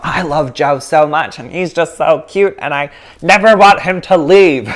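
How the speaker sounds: gushing clinginess. Pretend. Teacher playfully acts out clinging to her beloved Joe, gushing. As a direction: build to a loud, clingy plea. Acting out clingy love for partner.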